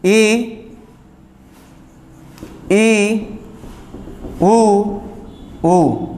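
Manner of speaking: drawn-out ई and उ vowels, mouth nearly closed; vowel demo. Shown as a demonstration. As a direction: sound out ई and उ clearly. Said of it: "ये जो चार हमारे स्वर होते हैं".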